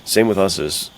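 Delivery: ordinary talk, nothing marked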